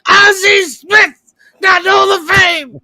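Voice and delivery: high, loud, slow outraged shout; mock radio rant. Imitating the sports host's rant, loud slow shout. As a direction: bellow it like a ranting host. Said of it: "and there'd be a silence".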